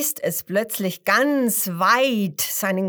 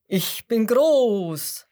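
slow, loud booming boast; loud slow crocodile call; calling loudly; 3 semitones higher, 6 dB louder, much slower than usual. A narrator's reading. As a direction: boom it out slowly like a big crocodile. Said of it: "Das erste ruft laut".

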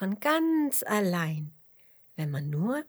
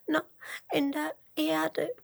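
gespieltes Schluchzen; 10 semitones higher than usual. A narrator's reading. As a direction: sob it out haltingly as a little worm. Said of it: "schluchzt der kleine Regenwurm". (langsam, hoch und schluchzend)

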